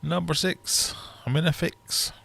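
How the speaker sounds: sing-song number calling; bingo caller. Acted. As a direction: call it like a bingo hall.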